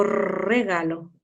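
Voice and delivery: "regalo" low and soft, slowly, with a rolled first R; strong initial R. Shown as a demonstration. Teacher offering the second option, low rolled R. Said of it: "al principio cuando era fuerte".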